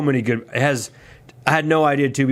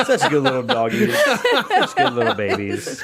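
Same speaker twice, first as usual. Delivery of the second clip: put-on baby talk; 12 semitones higher than usual. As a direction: coo at a puppy. Baby talk about dogs.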